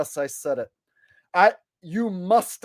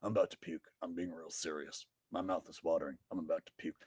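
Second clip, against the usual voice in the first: mock-queasy, low and soft Alex Jones; Alex Jones impression; 12 semitones lower, 17 dB quieter, faster than usual. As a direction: mumble it like a sick Alex Jones. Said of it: "the man who I'm going to be impersonating".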